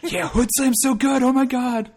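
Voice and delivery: loud, high, drawn-out enthusiasm; real high-pitched fan excitement; excited gushing. Real, not acted. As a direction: gush about a show you love. Gushing about a favourite show.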